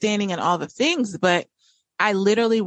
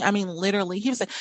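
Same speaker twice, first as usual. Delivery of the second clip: ordinary talk, nothing marked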